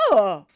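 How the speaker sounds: loud, disgusted groan of ugh; an exasperated daughter's loud ugh. Retold or imitated. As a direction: let out a sharp, fed-up ugh. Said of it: "verbally disgusted".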